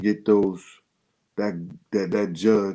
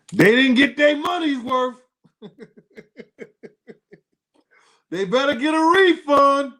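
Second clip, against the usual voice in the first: much higher and louder, sing-song mockery; hammed-up high mocking jab, laughing between; mocking taunt; 6 dB louder than usual. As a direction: taunt them in a silly high voice. Mocking whoever paid them.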